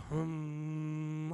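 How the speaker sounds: long, low mock-meditation hum; meditative hum. Alone with God on a mountaintop, mock meditative hum.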